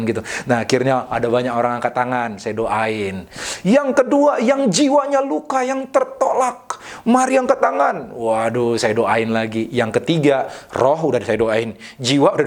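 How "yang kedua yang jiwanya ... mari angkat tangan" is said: altar call. Retold or imitated. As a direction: re-enact an altar call, pitched up and stretched. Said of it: "Altar call pertama".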